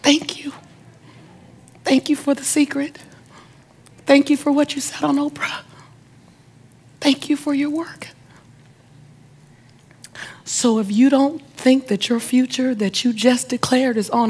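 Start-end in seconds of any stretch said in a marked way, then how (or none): 0.0-8.1 high, soft imitation of distant calls; mock yell of gratitude, hushed; retold yelled thanks. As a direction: call thanks softly from far away, with long pauses. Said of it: "they yelled out their window".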